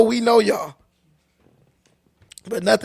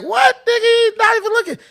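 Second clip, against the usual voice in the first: high, squawking imitation of a hothead; mock gang dude; 12 semitones higher than usual. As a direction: ham up the guy picking a fight.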